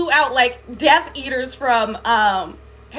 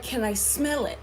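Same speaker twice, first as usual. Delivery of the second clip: quieter, lower quoted voice; hushed retold request; 3 semitones lower, 8 dB quieter than usual. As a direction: quote it low and soft, as the being. The being sidles up in retold dream.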